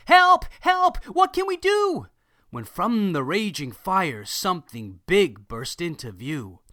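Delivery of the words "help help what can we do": very high, loud frantic calls; panicked cries for help. Acted. Panicked townsfolk crying out at the burning shed. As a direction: scream for help at the fire.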